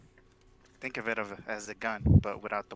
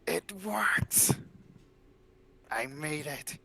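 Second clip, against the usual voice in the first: breathy, higher-pitched triumph; triumphant; 4 semitones higher, slower than usual. Acted. Inventor to herself on finishing her machine, triumphant. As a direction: exult like an inventor whose creation works.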